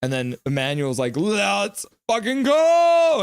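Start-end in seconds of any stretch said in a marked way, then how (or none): 1.2-3.2 slow, high, loud rallying cry; hype yell. Acted. Streamer voicing an excited fan in chat, hype yell. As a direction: stretch it out and belt the end.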